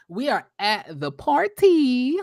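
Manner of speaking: slow, stretched words rising high at the end; drawn-out announcement. Pretend. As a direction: draw out "party" in a playful singsong. Drawn-out announcement of next scene.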